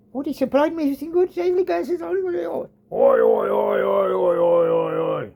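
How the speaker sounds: a hammed-up Australian 'oi oi' chant, pitch leaping high; mock the Aussie boss with a sing-song 'oi'; over-the-top imitation of an Australian yelling oi. Mocking the Australian supervisor.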